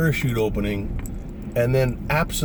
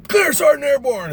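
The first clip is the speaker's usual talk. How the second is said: high, raised military reply; shouted acknowledgement; 4 dB louder than usual. Retold or imitated. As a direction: sound off to the sergeant.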